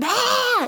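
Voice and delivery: put-on short high loud scream, a kid's "Ah!"; imitated child scream. Son screaming at mom by the toilet, imitated scream. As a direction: shriek it like a toddler in your face. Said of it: "And he's screaming".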